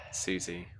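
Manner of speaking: ordinary delivery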